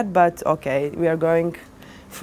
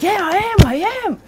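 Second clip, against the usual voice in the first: much higher, playful insisting voice; a light mock of the owner, pitched up; imitating the owner; 12 semitones higher, 4 dB louder, faster than usual.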